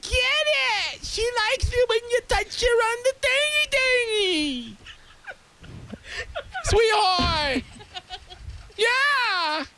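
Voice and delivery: high, slow cheering mom voice. Pretend. Mom cheering her daughter on in the joke scenario, high and slow. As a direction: coo and cheer like an overexcited mom.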